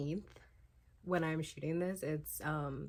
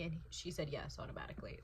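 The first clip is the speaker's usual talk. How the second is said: hushed whisper; 3 semitones lower, 7 dB quieter, faster than usual. Real, not acted. Reading quote hushed.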